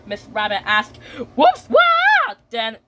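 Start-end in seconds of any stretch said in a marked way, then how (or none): 1.3-2.4 very high, loud mock yelp; startled slipping cry. Miss Rabbit slips on a toy car, high startled yelp. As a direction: yelp as if your feet slide away.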